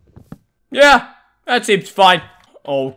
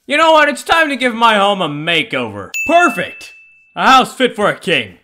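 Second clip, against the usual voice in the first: normal talking voice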